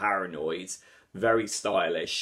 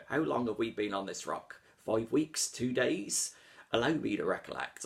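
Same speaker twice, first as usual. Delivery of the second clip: a lightly put-on old sailor's accent, softer; hint of a weathered seaman's accent, quiet; bad Willem Dafoe impression; 2 semitones higher, 4 dB quieter than usual. Reviewer quoting the trailer in a mild sea-dog accent. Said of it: "That's my bad impression of Willem Dafoe's accent".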